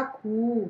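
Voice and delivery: खु drawn out as a long "khoo"; long vowel demo. Shown as a demonstration. Teacher joining ख to long ऊ for pupils, drawn-out खु. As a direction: hold the vowel of खु for extra time. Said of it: "लंबा तथा काफ़ी समय तक पढ़ा जाता है".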